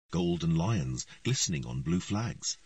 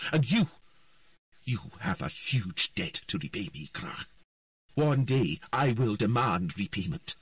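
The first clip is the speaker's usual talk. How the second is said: commanding queen; 5 semitones higher than usual. A narrator's reading. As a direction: lay down the law to the earl. Queen Calanthe giving orders.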